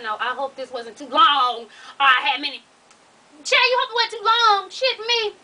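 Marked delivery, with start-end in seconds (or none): 3.4-5.4 high-pitched, exasperated comeback; incredulous retort. Real, not acted. Reader answering the writer's hope it wasn't long, incredulous. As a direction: throw it back in disbelief.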